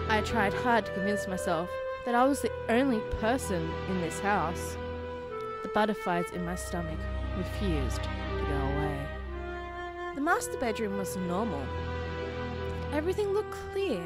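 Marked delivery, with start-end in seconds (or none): none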